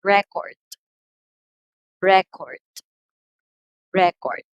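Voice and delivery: "Record" as RE-cord, first syllable punched; noun stress. Shown as a demonstration. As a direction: hit the front of each "Record", pausing between. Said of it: "When we say noun".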